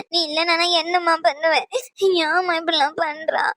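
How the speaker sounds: tearful begging to her mother; crying plea. Acted. (அழுதுகொண்டே கெஞ்சுதல்)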